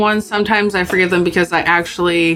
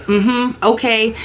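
passive-aggressive; 4 semitones higher, much slower than usual. Retold or imitated. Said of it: "passive-aggressive conversations".

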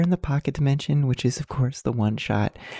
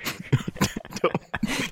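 breathy laugh; 12 semitones higher, 3 dB quieter than usual. Real, not acted. Laughs at his own bomb joke.

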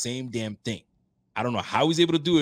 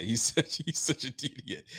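laughing; 5 dB quieter than usual. Real, not acted. Still laughing at friend.

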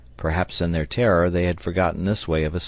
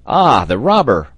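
loud, raised exclamation; indignant cry; 4 semitones higher, 9 dB louder than usual. A narrator's reading. Sailor spotting the hidden orang, crying out. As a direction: cry out at the culprit. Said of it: "cried Pencroft".

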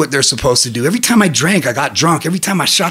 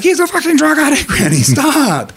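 family voice; 10 semitones higher than usual. Retold or imitated. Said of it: "They're like".

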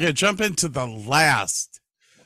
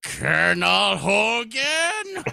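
drawn-out, higher catchphrase yell, hammed up; imitação do Hochstetter; 7 semitones higher, 4 dB louder, slower than usual. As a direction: stretch it out like a comic TV officer.

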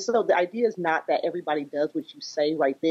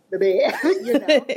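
laughing; 6 semitones higher, 5 dB louder than usual. Real, not acted. Laughing about sleeping child.

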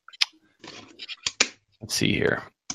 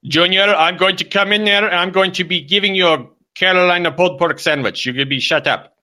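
high, loud put-on scolding; comic dad losing his patience; mock furious father; 9 semitones higher, 4 dB louder than usual. Father threatening Junior mid-call, mock furious.

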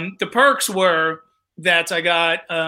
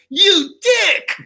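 high, loud stoner jab like Spicoli; Spicoli-style insult; 12 semitones higher, 5 dB louder than usual. Retold or imitated. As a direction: call him a dick, shocked and loud. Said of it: "I was like Spicoli in Fast Times".

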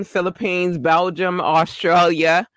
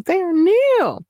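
high mock voice; slower than usual. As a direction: pop it up high and playful.

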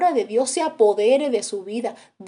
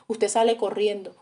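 ordinary delivery

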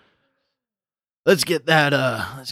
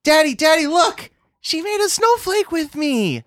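put-on little girl voice; 5 dB louder than usual. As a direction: squeal like an excited small child. Child voiced by GM.

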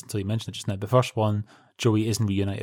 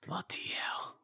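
whispered; 7 semitones higher, 12 dB quieter, slower than usual. Real, not acted. Reacting to sad ending.

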